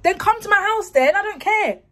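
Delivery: very high, loud defiant shouting; staged argument. Pretend. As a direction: yell down the phone for dad to hear. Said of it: "act like I'm having an argument".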